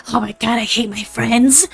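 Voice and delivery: half-whispered mock anger; little gremlin attempting an angry whisper; angry ASMR voice. Shown as a demonstration. Streamer voicing something she hates for ASMR, angry whisper. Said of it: "trying to sound angry and do an A S M R voice".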